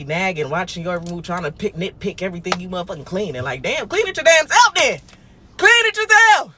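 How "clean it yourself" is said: mock angry yell. Angry retort to nagging parent.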